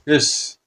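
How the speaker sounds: soft spoken hiss; hushed "his" trailing into sibilance; imitated fiend hiss. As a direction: let out a soft monster hiss.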